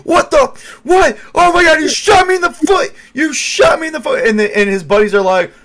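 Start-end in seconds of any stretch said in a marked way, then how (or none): 0.0-4.3 shrieking, high and loud in pain and rage; furious shout. Acted. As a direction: scream it like you've just been shot in the foot. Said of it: "and he's like".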